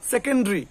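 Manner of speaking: সেকেন্ডারি squeezed to "se-ken-dri"; three-syllable "sekendri" for সেকেন্ডারি; correct pronunciation. Shown as a demonstration. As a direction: drop the vowel before ri in সেকেন্ডারি. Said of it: "কি হবে".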